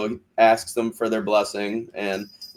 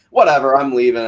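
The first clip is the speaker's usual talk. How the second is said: loud, dismissive mock huff; imitated fed-up outburst; retold angry exit; 3 semitones higher, 7 dB louder than usual. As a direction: act out storming off, louder. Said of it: "she fucking storms off".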